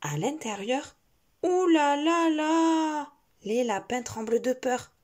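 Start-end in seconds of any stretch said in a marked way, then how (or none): 1.4-3.1 high, slow trembling voice; scared. Acted. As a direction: shiver with fear. Rabbits trembling in fear.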